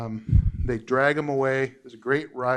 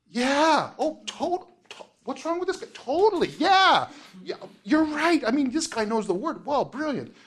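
impressed crowd; 11 semitones higher than usual. Acted. The crowd praising Paul's speech, high and gushing. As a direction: squeak it out like dazzled onlookers.

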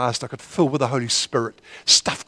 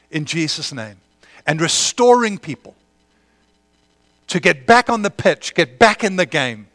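fervent prayer; 3 semitones higher, 5 dB louder than usual. Real, not acted. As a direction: pray over them with rising passion. Prays over the congregation.

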